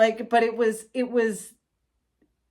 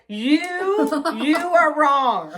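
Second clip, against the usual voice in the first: loud, drawn-out accusation; accusing outburst; 6 semitones higher, 4 dB louder, slower than usual. Retold or imitated. Driver telling off the cop, accusing. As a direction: land each word as an accusation.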